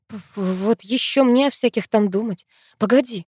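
ordinary delivery

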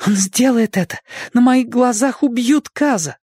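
she's watching a friend about to be shot; panicked inner cry, high; alarmed. Acted. Inej sees Kaz about to die.

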